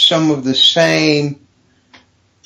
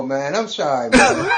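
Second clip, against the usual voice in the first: usual speaking voice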